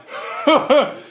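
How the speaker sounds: high mocking laugh at the barista; mock smug cackle. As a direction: cackle like a gloating jerk. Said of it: "he's like".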